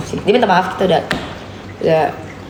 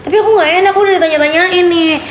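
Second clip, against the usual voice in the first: pleading; 8 semitones higher, faster than usual. Retold or imitated. Her retold plea.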